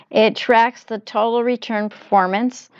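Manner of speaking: ordinary talk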